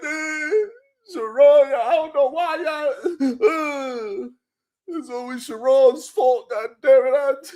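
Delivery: high, mock-tearful whine; hammed-up crybaby complaint; mock crying man. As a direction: whimper it like a crybaby. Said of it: "They be crying".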